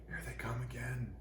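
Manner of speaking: hushed whisper. A narrator's reading. Little tree warning of the woodsmen, whispered. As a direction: breathe it out, barely audible. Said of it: "Shh, shh".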